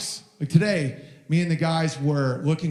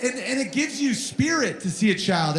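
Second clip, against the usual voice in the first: normal talking voice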